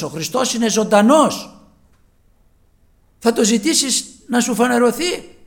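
loud, high, fervent preacher's call; preaching at full force. Acted. Preacher retelling his urging to a doubting man, preaching at full force. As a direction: proclaim it to the whole church, ringing.

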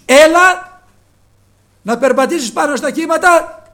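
loud, high commanding call; forceful call. Acted. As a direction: call out boldly across the water. Preaching at full force. (δυνατό προσκλητήριο, με πάθος)

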